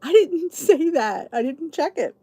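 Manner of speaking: high, loud shocked ooh breaking into a laugh; high embarrassed gasp with laughter. Retold or imitated. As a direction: caught out and mortified, laughing. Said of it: "I went".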